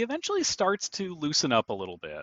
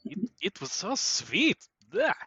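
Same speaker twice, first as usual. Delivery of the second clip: put-on Romanian accent; 5 semitones higher than usual. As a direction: complain in a fake Romanian accent, ending in disgust. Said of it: "He's Romanian suddenly".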